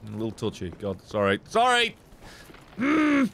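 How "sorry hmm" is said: high startled yelp then question. Real, not acted. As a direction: jump at the surprise. Startled by enemy.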